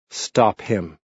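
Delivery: ordinary delivery, nothing marked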